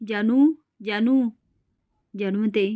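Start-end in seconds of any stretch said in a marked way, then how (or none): 0.1-1.3 slow, louder call from outside. Acted. Mangal calls Janu from outside the house, slow and loud. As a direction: stretch the name to reach someone indoors. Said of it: "बाहेरून आवाज देते".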